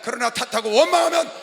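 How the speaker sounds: loud, relentless pulpit shout; full-force preaching. Acted. Pastor warning congregation against blame, shouted preaching. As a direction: drive each point home to the congregation.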